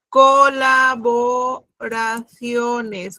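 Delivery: Colaboraciones drawn out syllable by syllable; very slow dictation. Shown as a demonstration. Teacher drags out Colaboraciones so students can find the stress. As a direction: spell colaboraciones out very slowly. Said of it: "¿Qué tipo de palabra es?".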